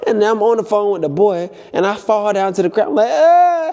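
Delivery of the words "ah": high loud frightened ah; scared yelp. Retold or imitated. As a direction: cry out high in fear. Retold scream falling down.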